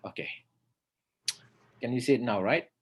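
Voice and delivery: ordinary delivery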